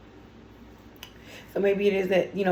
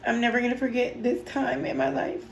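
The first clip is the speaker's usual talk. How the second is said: speaking through happy tears; real tears, pushing the words out; tearful; 4 semitones higher than usual. Real, not acted. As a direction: voice catching with overwhelmed joy. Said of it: "I'm already crying".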